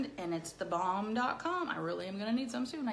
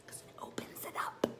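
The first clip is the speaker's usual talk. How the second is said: soft whispered aside; 9 dB quieter than usual. Acted. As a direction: let it slip as a secret.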